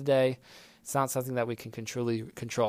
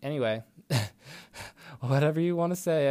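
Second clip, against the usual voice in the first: usual speaking voice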